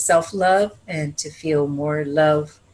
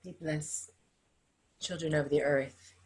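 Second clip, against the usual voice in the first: blessing prayer; 2 semitones lower than usual. Acted. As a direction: bless the room gently, like a healer. Leads a closing blessing.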